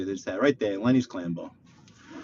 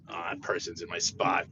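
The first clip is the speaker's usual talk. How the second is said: ordinary delivery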